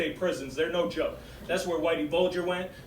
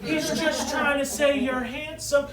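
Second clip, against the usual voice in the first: insistent lady; 8 semitones higher, slower than usual. Retold or imitated. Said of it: "she was like".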